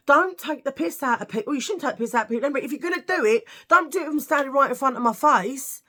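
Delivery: mildly ranting at the teasers; exasperated telling-off. Retold or imitated.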